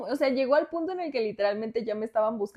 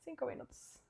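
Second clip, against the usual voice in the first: barely voiced, half asleep, much softer; sleepy mumble; 5 semitones higher, 18 dB quieter than usual. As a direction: mumble it from under the blanket. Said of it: "y yo así de que". (murmullo adormilado)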